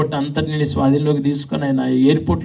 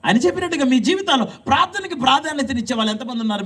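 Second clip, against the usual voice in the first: high, raised preaching voice; 9 semitones higher than usual. Acted. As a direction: preach it out to the congregation. (ఉద్రేకంగా ప్రసంగం)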